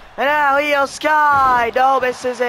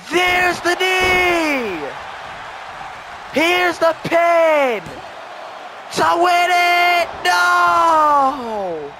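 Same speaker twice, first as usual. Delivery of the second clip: excited near-fall call; 4 semitones higher, slower than usual. Real, not acted. Commentator calling a near fall to viewers, excited. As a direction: ride the count with the crowd.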